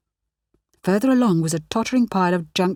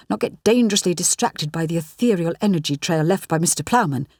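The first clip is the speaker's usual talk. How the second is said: ordinary delivery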